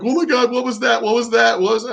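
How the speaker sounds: high, fast, mock-panicked; imitating a startled, panicky woman; freaked out. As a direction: squeal it like she's freaking out. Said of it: "She was freaking out".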